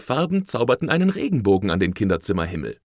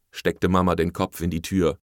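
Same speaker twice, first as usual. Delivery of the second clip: ordinary delivery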